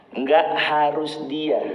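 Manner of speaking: "Nggak harus dia" read out slowly as one unbroken statement; slow, evenly joined "Nggak harus dia"; written line read neutrally. Shown as a demonstration. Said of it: "intonasi ucapan itu kan beda".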